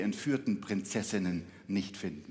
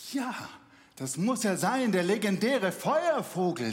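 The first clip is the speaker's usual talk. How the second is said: excited; 8 semitones higher, 5 dB louder than usual. Acted. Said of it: "denkt sich Iwan".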